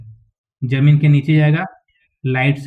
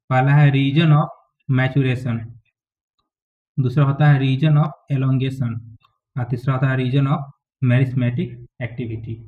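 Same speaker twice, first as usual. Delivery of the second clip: slow dictation; slower than usual. Shown as a demonstration. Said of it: "dictating root regions to students".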